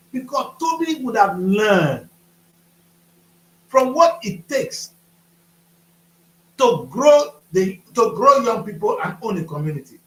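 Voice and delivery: slow, loud, drawn-out words; slow emphasis. Real, not acted. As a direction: spell it out slowly and forcefully. Emphatic lament about Toby.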